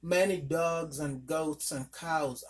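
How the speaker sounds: ordinary delivery, nothing marked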